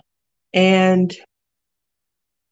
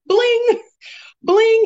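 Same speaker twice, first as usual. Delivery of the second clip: mock bling flourish; 14 semitones higher than usual. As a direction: toss the word out high, mock showing off.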